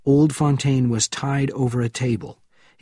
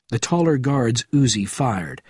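usual speaking voice

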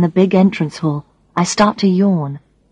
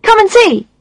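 put-on high, eager boy; little boy voice; 13 semitones higher than usual. A narrator's reading. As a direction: beckon like an excited child. Child character voice.

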